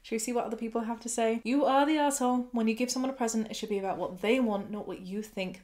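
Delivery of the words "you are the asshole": blunt verdict. Real, not acted. Reading a commenter's verdict.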